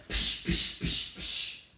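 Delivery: sharp unvoiced "psh" bursts; imitated gunshots. Woman recalls ducking over her kids as shots fired, imitated gunshots. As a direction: fire off gunshot noises with the mouth.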